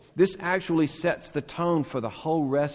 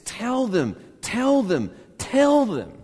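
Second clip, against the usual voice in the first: each tell them higher and louder; building intensity; 10 semitones higher, 4 dB louder than usual. Shown as a demonstration. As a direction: climb the repeats to a cry. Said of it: "It gets more and more intense".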